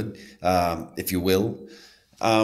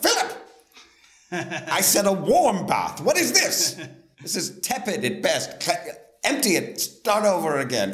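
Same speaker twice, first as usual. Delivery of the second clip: high, loud, hammed-up demanding guest; imperious mock shout; 12 semitones higher, 5 dB louder than usual. Guest scolds butler over tepid bath, mock outraged shouting. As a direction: yell pompous orders at a servant, hammed up.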